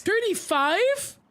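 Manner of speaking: shocked disbelief. Real, not acted. Shocked at the price.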